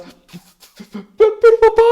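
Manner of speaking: agudo, fuerte, un niño pequeño que suplica al borde del llanto; niño suplicando. Pretend. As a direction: grita muy agudo como un niño asustado. Niño de tres años suplica. (niño pequeño suplicando a su papá, agudo y fuerte)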